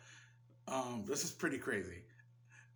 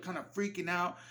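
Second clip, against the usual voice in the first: normal talking voice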